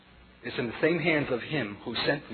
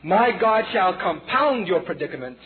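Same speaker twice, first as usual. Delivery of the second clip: prophetic rebuke; 6 semitones higher, 5 dB louder than usual. Retold or imitated.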